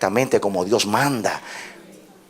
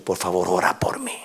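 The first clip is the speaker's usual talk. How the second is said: whispered, much softer admission; hushed confession; 5 semitones lower, 4 dB quieter than usual. Retold or imitated. Humbled pastor confessing to Radamés, whispered. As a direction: breathe the words like a broken man. Pastor on floor begs prayer.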